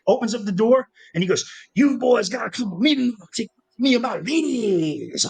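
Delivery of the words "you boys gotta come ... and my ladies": higher, slow showman's invitation. Retold or imitated. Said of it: "that's exactly how he talks".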